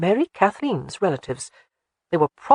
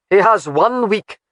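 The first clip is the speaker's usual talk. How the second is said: slow, louder, weighty "has"; stern ultimatum; 3 semitones higher, 6 dB louder than usual. Acted. Alec setting terms for Colin, stern and slow. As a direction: lay down the deadline coldly, stressing "has".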